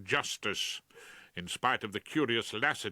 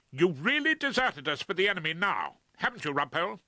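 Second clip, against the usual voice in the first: louder, higher, accusing; wrathful; 8 semitones higher, 4 dB louder than usual. Acted. Liz confronts Rumpole, wrathful and accusing. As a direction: lash out at a traitor. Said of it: "extremely wrathful Miss Liz Probert".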